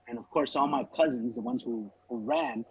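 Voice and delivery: ordinary talk, nothing marked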